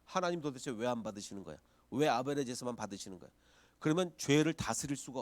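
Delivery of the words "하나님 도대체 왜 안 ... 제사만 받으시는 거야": a low, grumbling complaint to God; imitating a resentful Cain, voice dropped low; sulky complaint. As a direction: whine like a resentful brother. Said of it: "그렇게 생각을 해야".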